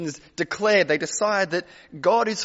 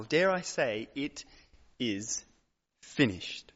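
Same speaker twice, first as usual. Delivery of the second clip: much lower and softer; quiet climax; 6 semitones lower, 5 dB quieter, slower than usual. Acted. Preacher echoing the cross, hushed and slow. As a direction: let "it is finished" sink, slow and hushed.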